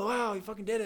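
hammed-up excited praise; mock impressed. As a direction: hype up your friend. Others praising him in dream.

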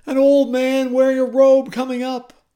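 loud, high séance wail; entranced medium. Acted. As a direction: intone the vision like a hammed-up medium. Medium describing her vision.

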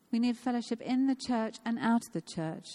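ordinary talk

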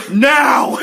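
very loud, high-pitched outburst; sudden captain's yell. A narrator's reading. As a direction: explode on the word like a mad captain. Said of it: "the captain breaks a glass over his own head".

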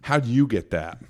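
put-down question, "you" stressed; insulting question. Shown as a demonstration. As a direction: stress on "you", doubting the comic deserved it. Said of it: "the most insulting question as a comic".

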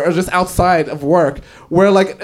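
normal talking voice

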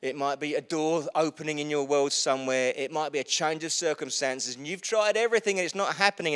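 normal talking voice

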